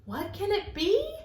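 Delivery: usual speaking voice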